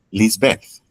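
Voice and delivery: ordinary talk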